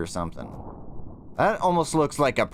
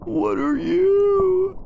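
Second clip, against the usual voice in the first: high, slow, drawn-out question; spooked; 5 dB louder, much slower than usual. Real, not acted. Player staring at an unknown creature making sounds, spooked and slow. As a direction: ask the dark thing slowly, uneasy.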